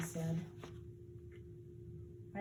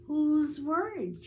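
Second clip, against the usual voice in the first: mock innocent; 7 semitones higher, 7 dB louder, slower than usual. Sister feigning unconcern.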